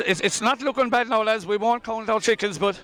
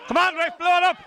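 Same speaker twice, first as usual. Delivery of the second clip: high, urgent plea to the ref; desperate plea; 8 semitones higher than usual. Real, not acted. Commentator pleading with the referee to end it, urgent. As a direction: beg the ref to blow the whistle.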